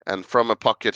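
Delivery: ordinary delivery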